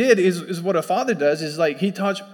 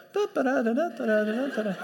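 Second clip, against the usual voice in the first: higher, softer da-da-da babble; oblivious babbling chant; 4 semitones higher, 5 dB quieter than usual. Retold or imitated. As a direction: chant nonsense syllables lightly, without a clue. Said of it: "I'm just like".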